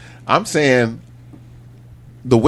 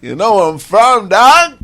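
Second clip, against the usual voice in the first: hyped; 9 semitones higher, 6 dB louder than usual. Real, not acted. Hyped shout-out.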